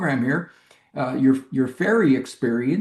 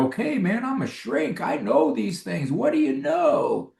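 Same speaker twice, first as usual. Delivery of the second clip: cocky know-it-all; 9 semitones higher than usual. Retold or imitated. Mocking his old cocky self.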